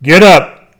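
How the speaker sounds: father calls out in an angry voice; angry father's call. Acted. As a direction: bark the child awake. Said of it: "calling out to him in an angry voice".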